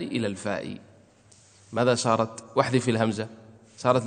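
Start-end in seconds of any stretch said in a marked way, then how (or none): none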